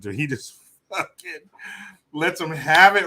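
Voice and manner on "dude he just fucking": ordinary talk, nothing marked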